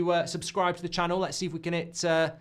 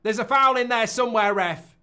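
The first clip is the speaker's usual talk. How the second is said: raised, high-pitched appeal; protesting to the referee; 6 semitones higher, 7 dB louder than usual. Real, not acted. Fan demanding a foul from the ref, indignant appeal. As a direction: call out loudly for a foul.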